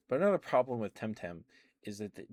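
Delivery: normal talking voice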